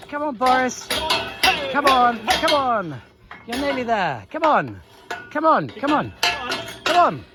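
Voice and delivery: high, pressing come on, come on; urging call. Real, not acted. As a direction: coax the bull up the ramp. Urging bull into trailer.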